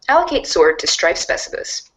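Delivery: normal talking voice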